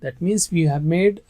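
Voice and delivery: usual speaking voice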